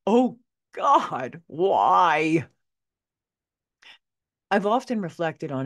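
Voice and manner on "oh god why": loud, drawn-out plea to heaven; hammed-up cry of exasperation; anguished lament. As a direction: wail it in mock despair. Mock lament over the accident.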